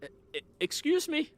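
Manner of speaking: high, stammering interjection; nervous official. Acted. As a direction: squeak out a hesitant interruption. Timid official interrupts with raised hands.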